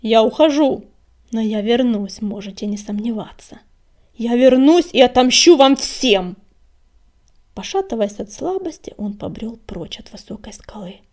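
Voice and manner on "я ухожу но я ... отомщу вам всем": cold fury. A narrator's reading. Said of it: "глаза его горели холодным бешенством".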